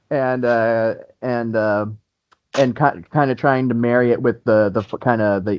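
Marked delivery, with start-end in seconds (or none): none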